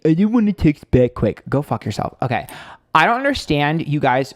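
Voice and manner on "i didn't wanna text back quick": higher, put-on mocking voice; silly raised voice for people you can't stand; mocking imitation. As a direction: mimic them with open scorn. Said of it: "thing where they're like".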